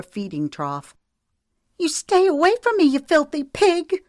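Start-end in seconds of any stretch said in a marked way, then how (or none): 1.8-4.0 high, loud, fast scream. A narrator's reading. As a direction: scream him away. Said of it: "she screamed".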